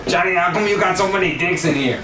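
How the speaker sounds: high, quick mimicked complaint; drunk buddy whining. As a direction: imitate a drunk buddy griping, fast and high. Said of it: "he comes in the door and he's like".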